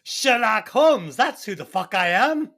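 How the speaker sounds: hammed-up British boast; British accent. Sherlock Holmes introduces himself in a bad British accent. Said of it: "worst British accent I've ever heard".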